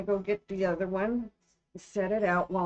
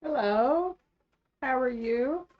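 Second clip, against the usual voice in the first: cheery character voice; 8 semitones higher, 5 dB louder, slower than usual. Pretend. Greets the stag in a voice.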